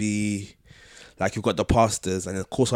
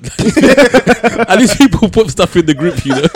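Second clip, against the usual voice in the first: laughing; 10 semitones higher, 12 dB louder, slower than usual. Real, not acted. Laughing through the sentence.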